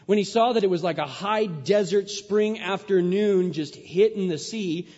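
loud, high preaching; preaching at full force. Acted. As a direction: preach it out to the congregation.

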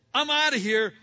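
loud, high burst: "I'm outta here."; fleeing Joseph blurts it out, loud and high; loud exclamation. Retold or imitated. Joseph fleeing the wife, loud high exclamation.

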